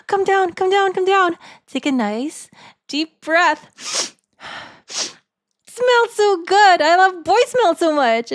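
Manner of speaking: mock-swooning fangirl reading a cringe message aloud; gushing fangirl voice. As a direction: play a swooning fan who can't contain herself. Reads a fan voicemail aloud.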